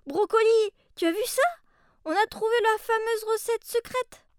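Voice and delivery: high, loud excited discovery; thrilled at the find. Acted. Excited at secret recipe.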